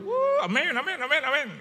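high, loud whoop into repeated amens; imitated excited congregation. Manado crowd responds to the preacher, loud excited amens. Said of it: "Oh, mereka".

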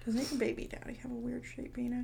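unvoiced gossiping question; whisper. Real, not acted. Cohost asking about the baby daddy, whispered. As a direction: lean in and whisper it. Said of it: "whispered gossip question".

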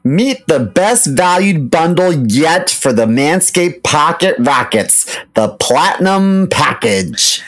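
slow, punchy product announcement; ad read. Acted. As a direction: announce the bundle like a big reveal. Co-host reads the ad.

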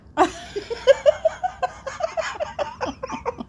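high, wheezy laughing fit; real helpless laughter; long laugh. Real, not acted. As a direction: laugh until it dies away. Laughing at own story.

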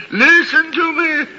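frantic plea to the ghost; desperate pleading. Acted. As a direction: beg the spirit to listen with rising panic.

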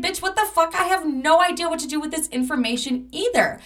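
a friend's mock outrage at the news; exasperated friend. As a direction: play the friend, baffled and annoyed. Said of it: "She's like".

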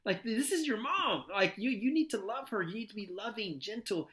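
high, lightly stern parental lecture; a hint of a stern father teaching respect; lecturing dad. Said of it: "I'm like".

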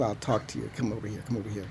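low, soft, leaning in to confide; hushed lion. Retold or imitated. The lion king calls the tiger over, soft and low. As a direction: draw him close and speak softly. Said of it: "he says".

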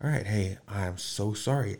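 hushed sorry after yelling; quiet apology. Retold or imitated. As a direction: drop the volume and apologize gently.

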